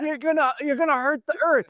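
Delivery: high, playful scolding voice; teasing guide. Retold or imitated. Guide teasing the guests about straws, in a high mock-scolding voice. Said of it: "he goes".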